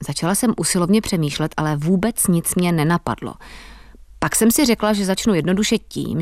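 ordinary delivery

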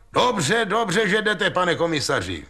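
high-pitched agitated call; excited high call to someone arriving. A narrator's reading. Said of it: "volal Ostrohradský na přicházejícího teď policejního úředníka".

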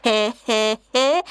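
soft hyena chuckle in a put-on voice; quiet hyena giggle. Hyena showing the lion how to laugh quietly, soft giggle. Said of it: "jak se smát potichu".

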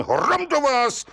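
high, slow exclamation of anger; the judge bursts out at the liar; angry outburst. Acted. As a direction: judge shouting at the lying defendant. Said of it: "rozkřikl se soudce".

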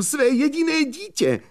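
mnohem výš, zoufale křičí; ještě jednou zoufale vykřikne; zoufalý křik. Acted. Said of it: "křičel znovu". (mladík zoufale křičí na vypravěče)

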